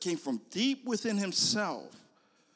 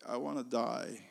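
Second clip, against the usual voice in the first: much softer, hopeless repeat; despairing; 4 semitones lower, 9 dB quieter than usual. Acted. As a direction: sink the voice as he says it again.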